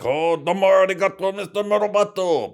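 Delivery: a high, loud cartoonish Japanese accent to mock. Mocking Japanese in an imagined takeover, in a hammed-up high Japanese accent. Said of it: "Say Japanese shit".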